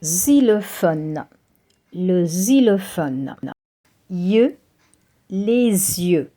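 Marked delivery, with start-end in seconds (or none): 4.1-6.3 "les yeux" run together as lay-zyeu, the S joining the Y; French liaison. Shown as a demonstration. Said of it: "you read it les yeux".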